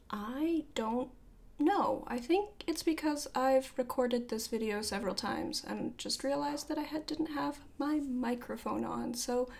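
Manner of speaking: ordinary delivery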